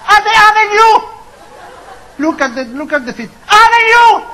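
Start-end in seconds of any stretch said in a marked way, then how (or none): 2.2-4.2 comic shout. Pretend.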